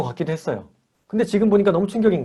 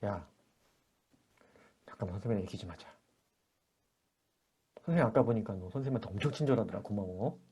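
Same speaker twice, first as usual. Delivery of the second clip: hushed aside; 7 semitones lower, 17 dB quieter, faster than usual. Retold or imitated. Teacher calls child aside.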